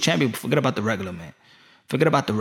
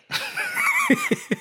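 high-pitched giggling fit; high laugh. Real, not acted. Comic laughs at diamond champion joke, high giggle. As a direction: break up laughing in a squeal. Cracks up at own bit.

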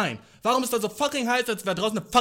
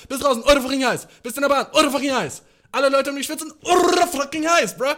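ranting louder; 4 semitones higher, 6 dB louder, faster than usual. Real, not acted. Escalating rant about the heat.

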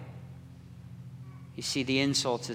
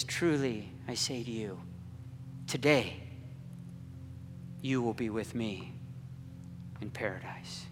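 gentle promise; 3 semitones lower, 10 dB quieter than usual. Acted. Jesus comforting dying thief.

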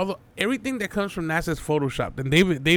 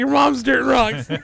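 high, loud, slow taunt; cocky shouted comeback; 9 semitones higher, 8 dB louder than usual. Retold or imitated. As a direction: yell the comeback like a brat. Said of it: "I was like".

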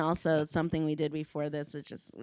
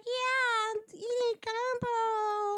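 much higher, slow, softer; goofy squeaky slow voice; silly voice; 4 dB quieter, much slower than usual. Acted. High silly voice.

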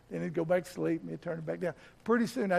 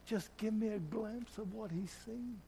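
begging prayer; 5 semitones higher, 6 dB quieter than usual. Retold or imitated. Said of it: "I am begging Jesus".

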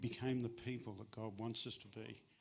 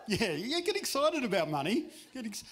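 much higher, louder hyped-up cheer; mock excitement; 10 semitones higher, 11 dB louder than usual. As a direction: hype the crowd up about money. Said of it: "Matt asked if I would speak on money".

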